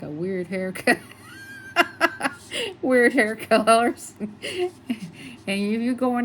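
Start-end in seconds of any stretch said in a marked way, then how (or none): 1.0-2.8 a very high laugh that nearly cries; high laughing squeal. Real, not acted. Laughing at own remark. 2.8-6.2 laughing. Real, not acted. Laughing remark.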